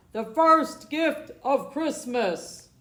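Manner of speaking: loud, slow proclamation; called out. A narrator's reading. Santa calls out to elves and children, booming. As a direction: announce it to the crowd, booming and slow. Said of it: "and called out".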